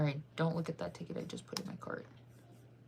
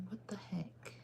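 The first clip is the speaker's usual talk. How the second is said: whisper; 12 dB quieter, slower than usual. Acted. Joking whispers mid-stream.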